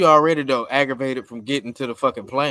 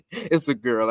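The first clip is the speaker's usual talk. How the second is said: ordinary talk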